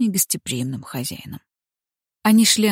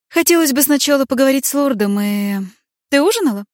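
caring lady; 7 semitones higher, 6 dB louder than usual. Acted. Бранка говорит со служанкой.

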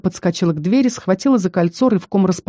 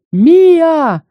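высокий громкий зов по имени; крикнуть имя издалека, высоко и протяжно; крик; 10 semitones higher, 7 dB louder than usual. A narrator's reading. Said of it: "раздался крик Ньюта". (громкий высокий окрик)

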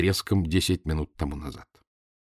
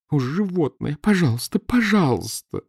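pleading; 7 semitones higher than usual. A narrator's reading. Wife begs to be lifted down.

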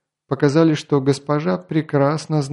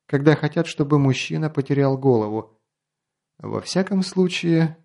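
plain reading voice

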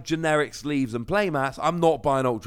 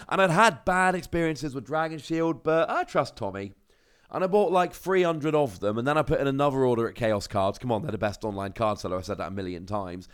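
ordinary talk

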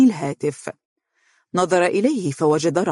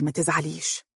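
"ما تزعليش" said much softer, gently; soft smiling comfort; 6 dB quieter than usual. Acted. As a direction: murmur it to calm her. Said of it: "قال مبتسما".

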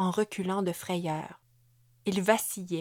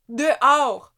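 firm, loud, higher "Dehors", falling hard; stern command; 6 semitones higher, 10 dB louder than usual. A narrator's reading. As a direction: order him out, cold and forceful. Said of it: "répéta Amélie". (ordre sec et fort)

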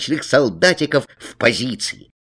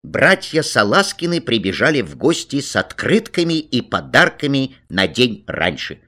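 ordinary delivery, nothing marked

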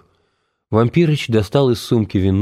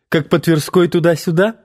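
high playful teasing question; teasing girl; 7 semitones higher than usual. A narrator's reading. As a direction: tease them lightly.

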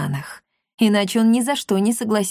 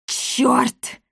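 higher, annoyed character exclamation; muttered curse; 4 semitones higher than usual. A narrator's reading. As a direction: swear in frustration at being caught out. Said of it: "выругалась Коптильда". (с досадой выругаться)

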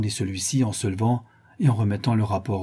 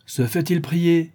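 gaily; 8 semitones higher, 4 dB louder, slower than usual. A narrator's reading. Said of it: "dit gaiement le gouverneur".

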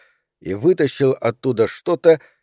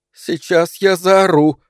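pent-up inner scream; 5 semitones higher, slower than usual. A narrator's reading. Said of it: "подумала она".

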